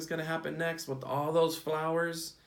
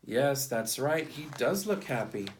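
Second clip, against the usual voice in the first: usual speaking voice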